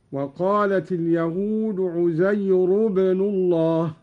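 عزير said uzayru with a bare u, no n; no nunation. Shown as a demonstration. Teacher demonstrating Qalun's uzayru without nunation. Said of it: "هكذا".

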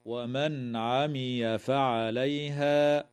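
"ومن عمي فعليها" slowly, noon clear before the ain; slow Quranic chant of "ومن عمي فعليها"; tajweed recitation. Shown as a demonstration. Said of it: "تظهر النون الساكنة عند العين".

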